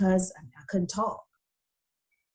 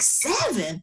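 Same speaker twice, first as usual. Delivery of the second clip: shocked disbelief; 7 semitones higher than usual. Retold or imitated. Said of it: "I'm like".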